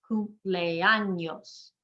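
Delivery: very slow "Cumpleaños" in four separate syllables. Shown as a demonstration. Teacher counting syllables, "Cumpleaños" drawn out in four parts. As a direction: stretch out "Cumpleaños", E and A kept apart. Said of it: "four syllables".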